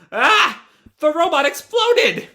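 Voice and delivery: high, loud cartoon shock; shocked scream. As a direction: cry out at an explosion. Shock at the robot exploding.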